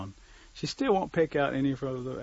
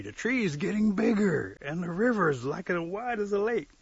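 high, loud, drawn-out amazement; slow, wide-eyed astonished cry; rising alarm; 8 semitones higher, 6 dB louder than usual. Acted. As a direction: exclaim as everything grows around you. Warrior alarmed as world grows.

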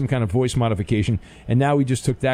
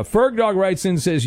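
ordinary delivery

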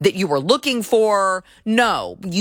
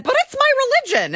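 much higher, quick whiny put-on voice; mock pious excuse; faster than usual. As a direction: squeak it out like a mocking fan. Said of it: "maybe they said".

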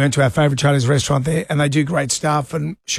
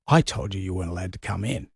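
stern bouncer voice; 9 semitones lower, 7 dB quieter than usual. Retold or imitated.

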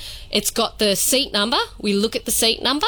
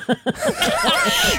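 high-pitched burst of giggling; high giggle. Real, not acted. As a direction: squeal with laughter. Presenter laughs after accent remark.

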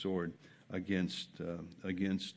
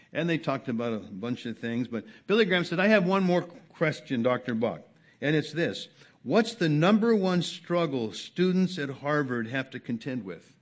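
loud, high preacher telling the story; fervent pulpit storytelling; preaching at full force; 6 semitones higher, 8 dB louder than usual. Acted. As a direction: drive the anecdote home from the pulpit.

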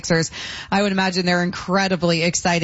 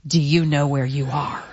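lower-pitched, grave imitation of a boss; very serious; 5 semitones lower than usual. Sports director warning a young job applicant, very serious. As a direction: mimic a solemn, weighty warning. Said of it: "It was, like, a very serious".

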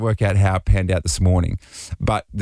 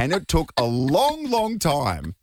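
high, stiff overacted kid apology, slow at the end; a child faking an innocent excuse; hammed-up schoolboy apology, higher; weird put-on voice; 8 semitones higher, slower than usual. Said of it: "Oh, mate, you're overacting".

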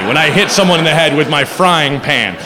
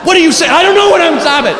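much higher, giggly panicked blurt; panicky giggle; 10 semitones higher, 3 dB louder, much faster than usual. Retold or imitated. Friend searching house, giggling in high panic. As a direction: squeak it out, half laughing in panic.

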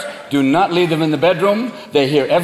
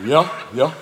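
low dog voice; dopey dog; 6 semitones lower, 3 dB quieter than usual. Acted. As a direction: answer like a sleepy, trusting dog. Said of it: "the moment you go to sleep".

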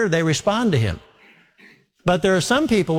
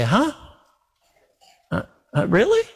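halting, then a high questioning rise; mock disbelief; 5 semitones higher than usual. Doubter answers God's promise, in hammed-up disbelief. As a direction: act baffled, pitch leaping up at the end.

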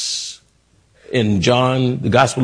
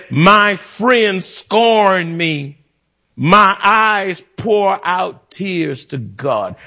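higher, slow, drawn-out scripture cry; preached lament; 5 semitones higher, much slower than usual. Acted. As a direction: stretch out each phrase as Job's complaint. Preacher dramatizes Job's lament.

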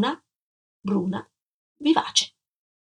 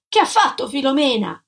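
raised, higher-pitched demand; shouted demand; 5 semitones higher, 4 dB louder than usual. A narrator's reading. Man demanding to know about his wife, shouted question. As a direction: cry out the question impatiently.

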